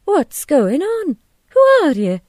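high, loud, indignant demand; shocked wife challenging a stranger; alarmed demand. A narrator's reading. Missus Hughes alarmed by the embrace.

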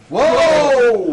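loud, high cry of wonder; amazed whoa. Acted. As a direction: shout it out in awe. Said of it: "let's do a whoa on one, two, three".

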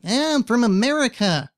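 mock American accent in a put-on voice. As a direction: imitate an awkward, mangled American accent. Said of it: "sounded like he was [name]".

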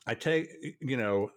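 normal talking voice

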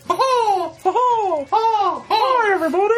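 high falsetto cartoon mouse; squeaky Mickey giggle. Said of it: "I'm Mickey Mouse".

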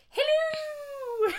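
high squeaky posh mock queen; mock Queen Elizabeth. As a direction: shriek it like a cartoon queen. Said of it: "Queen Elizabeth the Second".

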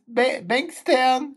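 high, slow mock sob; crying. Drunk passenger telling the driver her suburb, crying. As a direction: stammer the place name in a tearful high voice. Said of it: "She was crying".

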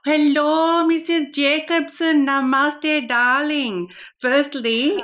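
a hammed-up Indian auntie accent, full of darlings; Rani's Indian accent. Host plays Rani greeting Karen, in a playful put-on Indian accent. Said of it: "I've got Rani sitting next to me".

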